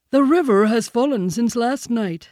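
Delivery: high, loud bear voice; Baloo the bear. A narrator's reading. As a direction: announce it loudly as the old bear.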